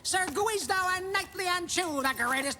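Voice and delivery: high, projected hawker's pitch; showman's announcement. Acted. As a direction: announce them like a fairground barker. Showman presents knights to the court.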